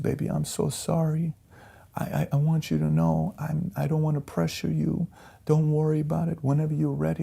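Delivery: retold crying wife, high and soft; tearful apology. Wife apologizing to husband for reading his note, tearful, retold. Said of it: "and she's crying".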